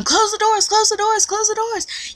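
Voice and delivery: high repeated urgent call; mock intercom yell. Staff over the intercom, high urgent calls. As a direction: call it out like a panicked announcement.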